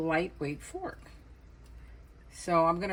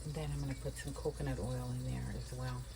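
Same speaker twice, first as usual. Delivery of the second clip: whispering; 3 semitones lower, 10 dB quieter than usual. Real, not acted. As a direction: keep it down to a whisper. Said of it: "I'm whispering because the baby's asleep".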